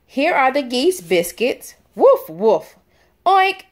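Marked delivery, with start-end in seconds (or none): 2.0-2.8 playful barking woof; cheerful puppy woof for kids; dog bark. Pretend. Dog barks in picture book. 3.2-3.6 high squeaky piglet oink; a higher, playful piglet oink. Pretend.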